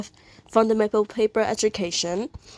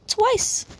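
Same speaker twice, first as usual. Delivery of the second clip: much higher squeak of disbelief; surprised correction; 13 semitones higher than usual. Real, not acted. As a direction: catch yourself with a yelp. Corrects herself about a repeat.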